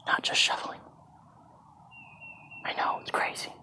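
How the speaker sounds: close, hushed whisper to camera; whispered aside. Real, not acted. Teacher leaning close to camera, whispering a fun fact. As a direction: lean in and whisper the secret. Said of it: "get close to the camera again just to".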